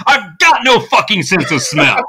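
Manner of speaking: bitter retort before he storms off; mock angry outburst. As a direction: imitate a fed-up musician blowing up. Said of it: "And he storms off".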